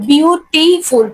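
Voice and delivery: "Beautiful" in three separate, emphasized beats; syllable by syllable. Shown as a demonstration. Teacher proving it takes three, "beautiful" split into syllables. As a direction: count out the syllables of "beautiful" as you say it. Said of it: "তিনবার".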